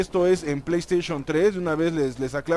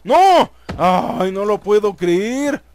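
loud cry of disbelief; loud dismay; 6 dB louder, much slower than usual. Real, not acted. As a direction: shout it out in defeat.

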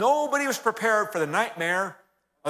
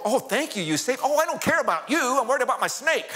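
frantic relief; 7 semitones higher than usual. Retold or imitated. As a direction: blurt it out, all panic for the snake. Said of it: "And the man go".

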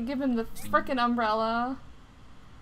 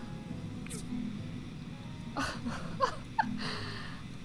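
high, soft airy giggle; breathless laugh; 11 semitones higher, 10 dB quieter than usual. Real, not acted. Player's machine runs out of fuel, breathless airy laughter. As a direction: let out a long wheezy laugh.

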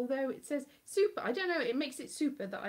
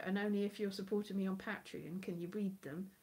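ordinary talk

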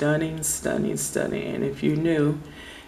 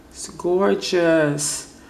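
very slow, loud, higher swoon; gushing admiration; 4 semitones higher, 5 dB louder, much slower than usual. Real, not acted. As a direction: stretch it out in delight. Gushing over the polish color.

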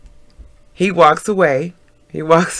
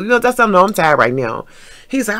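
ordinary delivery, nothing marked

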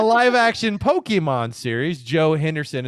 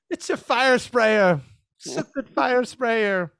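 lightly mimicked child actor, higher and slow; imitated child actor; 7 semitones higher, slower than usual. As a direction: draw it out in a mock kid's voice. Mimicking the kid's mocked line delivery.

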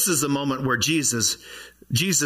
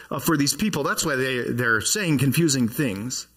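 ordinary delivery

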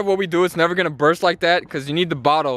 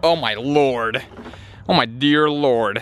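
mock awe; 7 semitones lower, slower than usual. Awed at giant Coke pool.

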